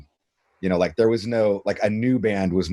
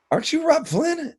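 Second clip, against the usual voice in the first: much higher, surprised question; recognizing fan. Retold or imitated. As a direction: squeak it out like a starstruck fan. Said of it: "they're like".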